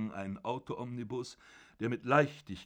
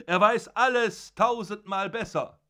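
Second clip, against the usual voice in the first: much higher, loud gloating cry, arm over the head; triumphant shout; 10 semitones higher, 8 dB louder than usual. Acted. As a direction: crow it out in triumph. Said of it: "rief er". (triumphierend gerufen, viel höher und lauter)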